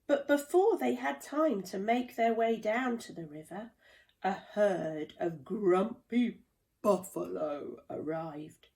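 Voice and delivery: ordinary delivery, nothing marked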